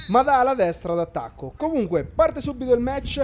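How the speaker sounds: lively play-by-play football commentary; match commentary. Acted. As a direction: call the match live. Live match commentary over video.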